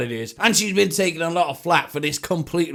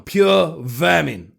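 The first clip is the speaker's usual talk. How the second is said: drawn-out contempt; 3 dB louder, much slower than usual. Real, not acted. Insulting media hacks.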